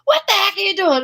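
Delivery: hammed-up startled yell at a kid; mock exasperated shout. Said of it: "I probably would have gone".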